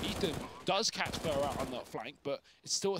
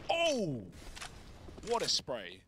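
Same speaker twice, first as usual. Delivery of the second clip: surprised exclamation; 3 semitones higher, much slower than usual. Real, not acted. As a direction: react to an incredible spray. Big play, caster exclaims.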